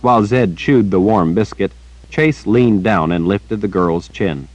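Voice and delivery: plain reading voice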